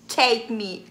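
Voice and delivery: ordinary talk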